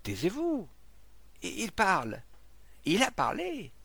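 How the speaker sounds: high, startled crowd exclamations; excited outcry. A narrator's reading. Onlookers hearing the fallen man speak, excited outcry. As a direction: cry out in astonishment.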